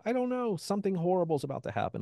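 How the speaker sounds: high, fast, frightened blurt; panicked. Retold or imitated. To his roommates in the yard, panicked high blurt. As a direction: gasp it out like doom is coming.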